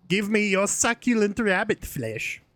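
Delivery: high-pitched German doctor voice; put-on German accent, pitched high. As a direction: relish it in a cartoonish German accent. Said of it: "It's the fucking German doctor".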